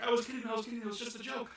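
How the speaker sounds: high, hasty backpedaling; hammed-up backtracking. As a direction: plead it was only a joke. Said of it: "She's like".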